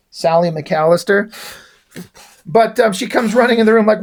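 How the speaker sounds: ordinary delivery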